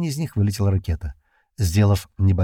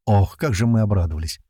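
ordinary delivery, nothing marked

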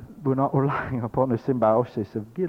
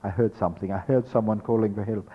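ordinary delivery

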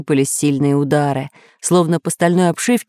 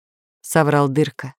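ordinary delivery, nothing marked

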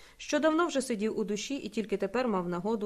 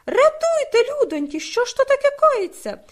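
much higher, loud hysterical shriek; frantic screaming; 9 dB louder than usual. A narrator's reading. Young man clutching his head, screaming hysterically. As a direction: scream like a madman. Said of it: "кричав, наче навіжений".

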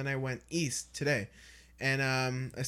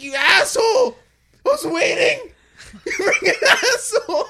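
mock angry yell; 12 dB louder than usual. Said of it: "He's like".

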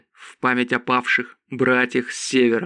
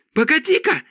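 high-pitched cry. A narrator's reading. Priscilla stops everyone after the mana burst, high-pitched cry. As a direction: exclaim sharply, far up in pitch. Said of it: "закричала Присцилла".

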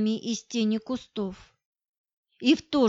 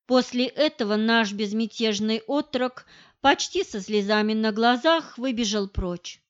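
plain reading voice

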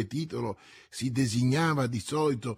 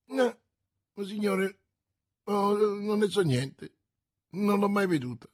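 frightened merchant; 7 semitones higher than usual. A narrator's reading. Bonacieux pleads before the cardinal.

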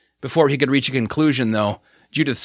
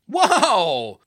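shout; 8 semitones higher than usual. A narrator's reading. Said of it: "they heard Jason shout".

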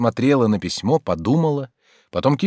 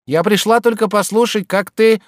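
high-pitched maidservant voice; defensive maid; 10 semitones higher, 4 dB louder than usual. A narrator's reading. Maid excuses her eavesdropping to servant, high and defensive. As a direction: explain yourself in a high woman's voice.

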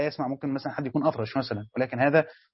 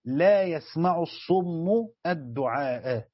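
slow recitation; much slower than usual. Shown as a demonstration. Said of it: "عندنا قراءة الآن".

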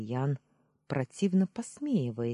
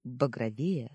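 ordinary delivery

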